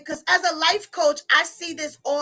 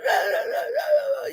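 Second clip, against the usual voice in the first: real high-pitched shriek of laughter; high squealing laugh; 8 semitones higher than usual. Real, not acted. Host cracking up after falling in front of viewers, squealing laugh. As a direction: let out a squealing helpless laugh. Laughing fit on live stream.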